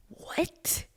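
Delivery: stunned little squeak; horrified disbelief. Acted. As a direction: let it escape, barely voiced. Horrified at her own blunder.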